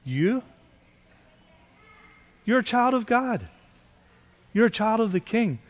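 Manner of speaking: gentle angel's rebuke. Retold or imitated. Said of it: "put his hand on your shoulder and say".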